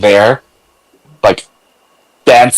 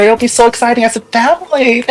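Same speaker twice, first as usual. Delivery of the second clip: mock excited; 10 semitones higher than usual. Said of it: "it's like".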